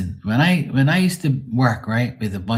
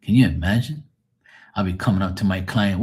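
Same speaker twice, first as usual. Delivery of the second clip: normal talking voice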